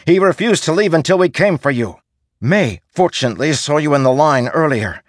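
loud high urgent pleading; rally him to his feet; urgent shout. Acted. Soldier urges loudly in battle.

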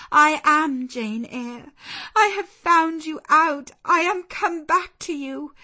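high, loud, emotional assurance; fervent declaration. A narrator's reading. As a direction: declare it with rising joy. Jane declares her return to him.

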